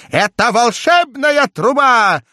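high, loud, slow ecstatic shout; jester shouting. A narrator's reading. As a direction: proclaim it in delight. Шут ликует. (восторженный крик шута)

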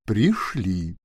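ordinary delivery, nothing marked

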